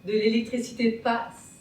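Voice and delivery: soft, low and intimate; quiet, loving wonder; tender, hushed admiration. Acted. Hands lovingly embracing, in contrast with feet.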